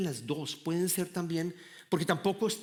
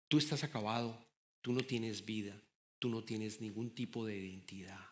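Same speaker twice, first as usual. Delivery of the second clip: a low, softer taunting whisper-like voice, as the enemy; the enemy's voice; 6 semitones lower, 8 dB quieter than usual. Acted. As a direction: murmur it softly like a tempter wearing you down. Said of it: "el enemigo te va a afectar y decir". (voz baja e insinuante del enemigo)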